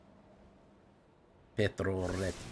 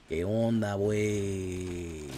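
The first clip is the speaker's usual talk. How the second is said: put-on lightly done Mexican accent; much slower than usual. Streamer joking to chat in a mock Mexican accent. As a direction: toss off a casual mock Mexican slang greeting. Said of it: "my Mexican accent".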